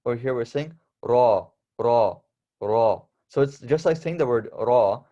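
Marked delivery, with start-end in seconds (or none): 1.8-3.0 raw with a heavier, fuller R; heavy ra. Shown as a demonstration. Teacher drilling the heavy Arabic ra. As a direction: weigh down the R in raw. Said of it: "making the R sound more heavy".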